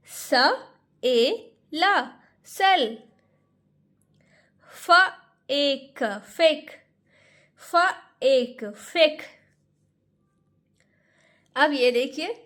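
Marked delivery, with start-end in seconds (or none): 0.1-9.2 फेक drawn out slowly, sound by sound. Shown as a demonstration. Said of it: "spelling demo". (धीरे-धीरे जोड़कर फेक)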